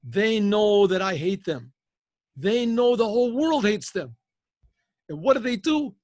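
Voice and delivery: loud, high, emphatic speech; impassioned declaration. Acted. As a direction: declare it forcefully, stretching the opening words. KGB head's impassioned speech.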